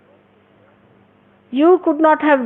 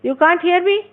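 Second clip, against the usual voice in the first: yelling across the room; 7 semitones higher than usual. Real, not acted. Calling to listeners upstairs.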